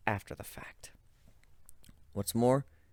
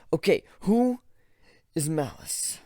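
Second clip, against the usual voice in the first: higher, louder startled ask; startled question; 5 semitones higher, 4 dB louder than usual. Acted. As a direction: blurt it out confused. Confused sudden question.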